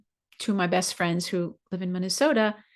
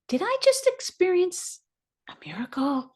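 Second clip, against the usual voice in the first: pitched high, trailing into a whisper on "a miracle"; awed wonder; 10 semitones higher than usual. Retold or imitated. Asking herself about the miracle, high then whispered. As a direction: ask it in stunned, hushed disbelief. Wondering aloud after the service.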